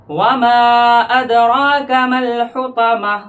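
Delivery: slow, sung tajweed recitation; Quran recitation. Shown as a demonstration. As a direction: chant the verse with full tajweed melody. Recites verse melodically.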